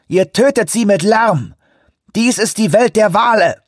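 high, loud preaching to the boats; loud invocation. A narrator's reading. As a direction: declaim like a prophet raising his arms. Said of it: "hob beschwörend die Arme". (beschwörend, laut predigend)

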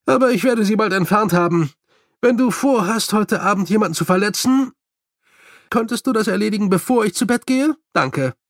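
lightly voiced story character. A narrator's reading. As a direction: voice a character's brisk dialogue.

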